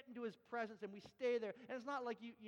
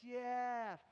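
put-on dawning realization in a Thai accent; long, loud Jeff, imitating Thai speakers; Thai-accented delighted "oh, I get it"; imitated Thai listeners; 4 semitones higher, 6 dB louder than usual. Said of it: "And they".

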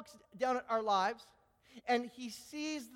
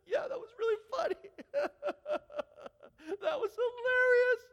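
much higher, sobbing through forced laughs; tearful laugh, voice pitched way up; crying laughter; 6 dB louder than usual. Acted. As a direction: act out someone crying while insisting it's funny. Person crying but says it's funny.

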